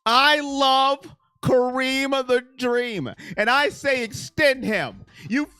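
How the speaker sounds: loud, emphatic declaration; defiant passion. Real, not acted. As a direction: push every phrase out loudly and firmly. Defiant declaration of love for player.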